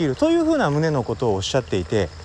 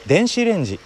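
standard Japanese accent; 5 semitones higher than usual. Shown as a demonstration. Showing what 電子レンジ becomes for a Kansai speaker attempting standard Japanese, in standard intonation. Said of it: "標準語で喋ろうと思うと".

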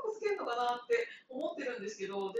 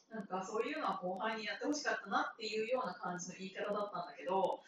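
normal talking voice

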